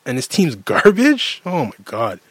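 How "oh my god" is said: normal talking voice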